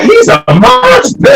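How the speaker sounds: high, slow, loud mock outcry; mock accusing cry. Man imitating people crying out in fear that he is a monster. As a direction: play the scared people crying out. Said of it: "they be like".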